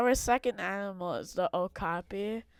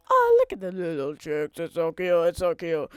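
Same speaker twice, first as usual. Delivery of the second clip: cooing; 7 dB louder than usual. Real, not acted. Cooing over baby chicks.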